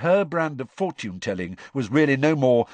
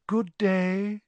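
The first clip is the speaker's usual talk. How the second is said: misty voice; 10 semitones higher than usual. A narrator's reading. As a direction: float the greeting mistily. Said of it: "the misty voice of Professor Trelawney".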